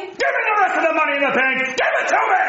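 rapid, high shouted demand; fast angry demand. Acted. Fast yelled robbery demand.

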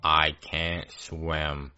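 very slow, low monotone; deadpan, every word stretched on one dull note. Acted. Ben telling his classmate mid-drowning, in dead monotone. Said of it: "in the most monotone voice".

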